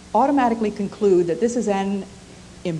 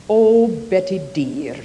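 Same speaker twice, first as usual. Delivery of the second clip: put-on Scottish accent; 8 dB louder, slower than usual. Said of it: "in her wonderful Scottish accent".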